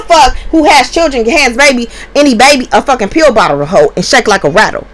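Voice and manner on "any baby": usual speaking voice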